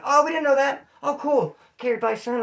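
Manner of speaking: high, cheery dispatcher on the phone. Retold or imitated. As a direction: chirp it like an office clerk. Voicing company dispatcher on phone.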